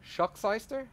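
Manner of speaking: "Schuckseister" tried as a German word, rising like a question; tentative pronunciation attempt. Shown as a demonstration. Asked to pronounce a foreign word, tentatively attempts "Schuckseister". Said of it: "requesting that I try to pronounce that word".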